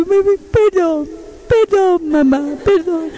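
put-on pleading, drawn-out child voice; tearful little child. Littlest son apologizing to mom, mock crying. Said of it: "viene el chiquitito llorando".

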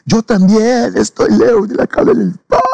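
high wailing shout, drawn out slowly; imitated tearful cry. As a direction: wail it like someone breaking down in tears. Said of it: "él empezó a llorar y a decir".

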